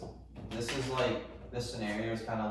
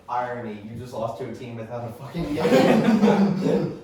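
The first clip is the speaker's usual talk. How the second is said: laughing loud read; 12 dB louder than usual. Retold or imitated. Reading out a mocking tweet.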